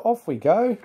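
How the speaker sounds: high, lilting send-off; storyteller's cheery driver call; urging on. A narrator's reading. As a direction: set off with a lifted voice. Driver urges Percy to set off.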